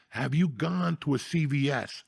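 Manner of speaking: usual speaking voice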